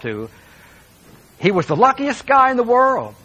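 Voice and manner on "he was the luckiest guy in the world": much higher and louder, gushing with envy; admiring gossip. Retold or imitated. Townspeople envying the wealthy man, gushing high and loud. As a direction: gush about him like starstruck neighbors. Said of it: "people would say".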